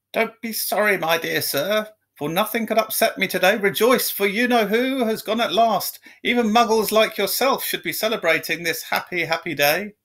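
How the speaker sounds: high squeaky elated voice; squeaky old man. A narrator's reading. Old man greeting a stranger in the street, high and squeaky. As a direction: squeak it out with glee. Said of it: "in a squeaky voice".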